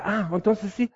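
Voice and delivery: high, sudden "ah" of understanding; light up as it finally makes sense; relieved realization. Retold or imitated. A listener finally grasping the good news, mildly mock-relieved.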